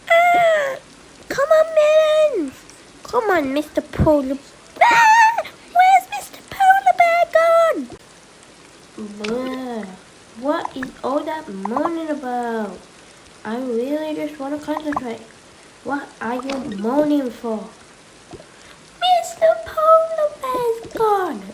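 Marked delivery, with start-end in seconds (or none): 0.1-7.9 high, loud crying shout; panicked wail. Acted. Child wailing over missing Mister Polar Bear, high cry. 19.0-21.4 high, slow whine; whiny lament. Acted. Child whining to parent about lost toy, high whine.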